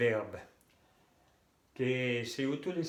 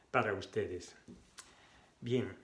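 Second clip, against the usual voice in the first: usual speaking voice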